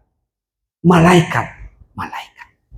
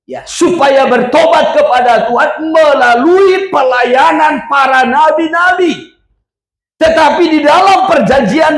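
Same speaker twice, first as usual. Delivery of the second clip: high-pitched, driving sermon delivery; forceful preaching; 9 semitones higher than usual. Real, not acted. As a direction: preach it with rising fervour.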